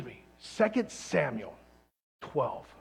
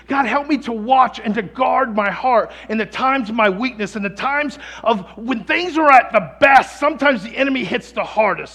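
louder, higher impassioned prayer-sermon; fervent preaching; 5 semitones higher, 9 dB louder than usual. Acted. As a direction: pour it out like a preacher at full force. Preaching at full force.

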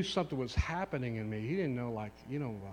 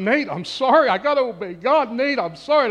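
loud, higher, pleading apology; emotional plea; 11 semitones higher, 14 dB louder than usual. Retold or imitated. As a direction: beg your son's forgiveness out loud. Retelling tearful restaurant confrontation.

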